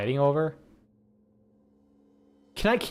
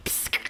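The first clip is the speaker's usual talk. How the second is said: soda can hiss; 5 dB quieter than usual. Shown as a demonstration. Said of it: "pretend I'm opening up and go, like".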